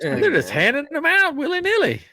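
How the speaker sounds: high, put-on amazed voice; imitating him marvelling at free stickers, in a high voice; imitating Katrin. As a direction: ham up his sticker excitement. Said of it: "he was like".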